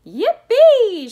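very high, loud whoop; soaring celebratory yell; joyful shout. A narrator's reading. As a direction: whoop with glee. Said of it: "shouted Grandpa Joe again".

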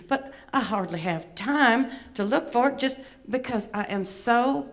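fussy, vain Southern belle puppet; Miss Bevy. Acted. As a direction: complain in a lilting Atlanta accent. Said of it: "she does have a bit of an accent".

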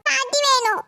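put-on very high toddler voice; little child. Grandson choosing grandmother over mother, little child's voice. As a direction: answer as a small child, tiny and high.

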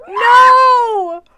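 high loud dismayed no; loud cry. Real, not acted. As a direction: scream in dismay. Friend killed her.

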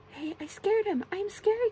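loud, high-pitched triumphant cry; excited cry. A narrator's reading. SpongeBob realising he scared the Dutchman, crying out. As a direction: burst out with proud storybook excitement. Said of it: "cried SpongeBob".

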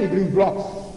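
ordinary delivery

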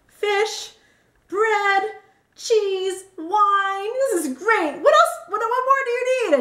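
high, gleeful ticking-off of a list; hammed-up enthusiasm, pitched well up; mock delight. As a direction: relish each item, building to playful exclamation. Acting out her delight to Jeff.

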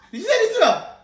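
loud, horrified outcry; shocked. Real, not acted. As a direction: exclaim it loudly in dismay. Shocked the message was sent.

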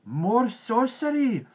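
high, drawn-out alarmed shout; loud, high crowd yell; villagers shouting. A narrator's reading. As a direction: cry it out slowly like a frightened mob. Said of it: "shouted the villagers".

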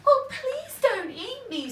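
very high, loud frightened squeak; pleading spider. A narrator's reading. Spider begging troll not to eat him, high squeak. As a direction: beg for its life in a tiny voice. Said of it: "says the spider".

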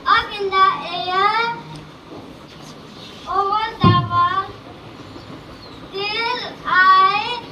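loud, slow, careful school recitation; poem recitation. Acted. Child reciting a poem to the judges, slow and loud. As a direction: declaim each line to the hall.

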